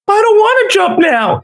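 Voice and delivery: mock pleading woman. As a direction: squeak it out like the woman on the ledge backing down.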